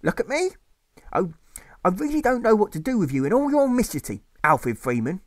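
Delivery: a mother at her wits' end with her son; exasperated mother. Acted. Mother scolding Alfred.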